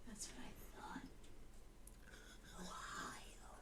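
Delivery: whispered, conspiratorial; knowing whispered aside. Acted. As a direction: breathe it like a secret. Knowing reply about Ohio.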